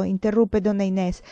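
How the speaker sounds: plain reading voice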